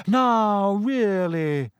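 high, airy reproach from a mystic seer; a dreamy seer scolding the class; Trelawney's voice. Acted.

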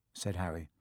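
usual speaking voice

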